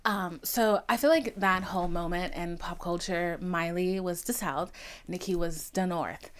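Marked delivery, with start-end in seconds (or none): none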